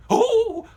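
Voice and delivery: high, loud terrified shriek; a comic fearful shriek. Acted. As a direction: scream in sudden fright. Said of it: "I would always shriek".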